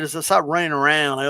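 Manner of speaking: normal talking voice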